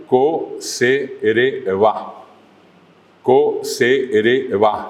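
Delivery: Co-se-re-va very slowly, stressed on final VA; acento final. Shown as a demonstration. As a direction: separate every syllable of Co-se-re-va, landing on va. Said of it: "Siempre el acento final". (Co-se-re-va silabeado, con acento final en VA)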